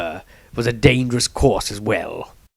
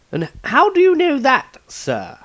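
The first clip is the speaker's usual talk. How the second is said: put-on much higher, questioning young man; young Maestro voice; 3 dB louder than usual. A narrator's reading. Maestro doubts the Patriarch, higher young voice. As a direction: pitch up into the doubtful apprentice.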